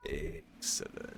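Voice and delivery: slow, hushed villain purr; mad scientist. Retold or imitated. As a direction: savor it like a scheming villain. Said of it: "He said like a mad fi-, scientist villain".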